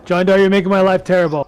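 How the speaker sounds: loud, exasperated complaint; half-joking loud groan at Jandar. Real, not acted. Raid leader scolds mistaken player.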